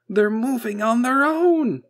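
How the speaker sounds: high, loud, drawn-out cry of alarm; panicked shout. A narrator's reading. Technician telling the boss about the antennas, panicked. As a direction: shout it slowly in horror.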